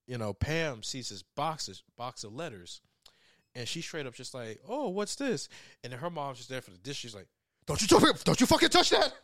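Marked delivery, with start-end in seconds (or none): 4.7-5.5 high-pitched curious exclamation; acted-out innocent curiosity; curious discovery. Retells girl finding letters box. 7.7-9.1 imitated furious, high-pitched yell; loud acted-out panicky scolding; angry mom. Retells mom angrily stopping daughter.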